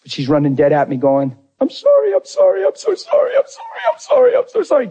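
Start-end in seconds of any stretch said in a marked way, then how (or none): 1.6-4.9 very high, pleading mock sob; imitated crying apology. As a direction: retell a frantic, tearful stream of apologies. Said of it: "And she's crying".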